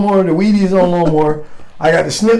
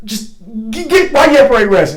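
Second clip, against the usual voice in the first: stammering high start that jumps louder; flustered, losing his train of thought; 7 semitones higher, 3 dB louder than usual. Retold or imitated. Angry sergeant major losing his thread at a soldier, flustered. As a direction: stumble over the order, then force it out. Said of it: "trying to get his train of thought".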